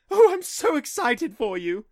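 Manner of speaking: high, loud gushing mom; sing-songy mom voice. Retold or imitated. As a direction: gush it like a doting mom. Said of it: "imitating his mom's sing-songy voice".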